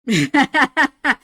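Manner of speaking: high, loud staccato witch laugh; Minecraft witch cackle. Shown as a demonstration. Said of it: "I can also do a perfect, uh, Minecraft witch".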